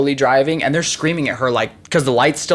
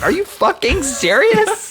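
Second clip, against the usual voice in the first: high, slow, stunned outrage; man charged for his rescue ride, high and slow in outrage; outraged disbelief; slower than usual. Retold or imitated. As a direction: stretch it out in disbelief. Guy outraged at being charged.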